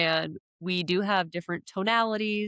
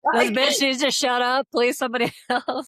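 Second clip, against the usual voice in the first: mock irritation; 5 semitones higher, 4 dB louder than usual. Acting out an irritated listener.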